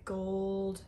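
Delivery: normal talking voice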